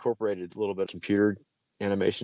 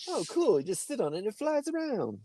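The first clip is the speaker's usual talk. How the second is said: high naive voice. Retold or imitated.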